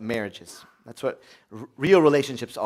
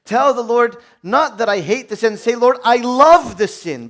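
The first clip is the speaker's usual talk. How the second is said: fervent quoting; 7 semitones higher, 6 dB louder than usual. Retold or imitated. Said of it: "he says".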